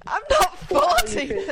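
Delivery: high, loud, drawn-out objection; indignant protest. Real, not acted. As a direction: protest loudly through a laugh. Protests at fortieth birthday wish.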